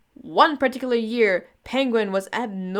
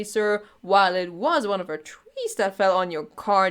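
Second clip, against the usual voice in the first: normal talking voice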